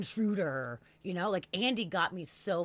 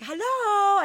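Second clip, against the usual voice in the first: bright sing-song hello, much higher and louder; cheery hello; 11 semitones higher, 7 dB louder than usual. Retold or imitated. Calling to the roommate she thinks came home, cheery. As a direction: call out a cheerful hello to the house.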